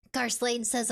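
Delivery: normal talking voice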